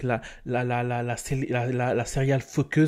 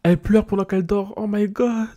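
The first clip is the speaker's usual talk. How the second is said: shocked; 7 semitones higher, 5 dB louder than usual. Real, not acted. Shocked Sim cries asleep.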